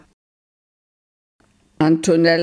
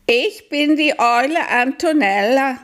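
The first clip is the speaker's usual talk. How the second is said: eager owl; 9 semitones higher than usual. Acted. Owl introduces itself. (sehr hohe, langsame Eulenstimme)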